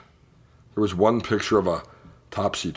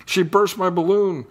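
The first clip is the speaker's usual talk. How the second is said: high, excited shouts of a chasing crowd; shouting crowd; 9 semitones higher than usual. A narrator's reading. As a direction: yell after the runaway cat. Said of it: "everyone after her shouting".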